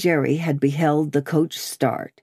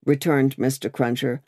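plain reading voice

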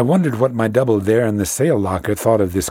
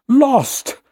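much higher, louder cry of despair; 4 dB louder than usual. A narrator's reading. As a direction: cry out that all is lost. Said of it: "absolutely cried out".

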